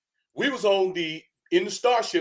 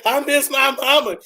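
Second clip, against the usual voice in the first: mock whimper; 7 semitones higher, 5 dB louder than usual. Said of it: "he's crying his eyes out".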